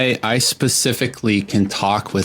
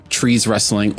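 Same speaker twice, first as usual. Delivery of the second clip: usual speaking voice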